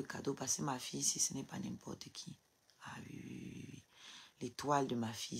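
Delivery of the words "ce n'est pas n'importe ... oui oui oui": barely voiced, almost a whisper; soft private murmur; hushed murmur. Real, not acted. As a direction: drop to a near-whisper. Hushed aside about her daughter.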